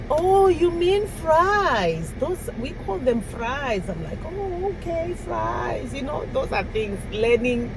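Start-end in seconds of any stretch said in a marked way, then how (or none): 0.1-3.9 drawn-out, higher "oh" of realization; higher, slowed mock waiter catching on; waiter correcting her. Waiter explaining fries to a customer, dawning correction. 4.3-5.9 high, softened "oh, okay"; acted penny-dropping, pitched well up; mock realization. Customer giving in to the waiter, mock realization. Acting her own dawning understanding.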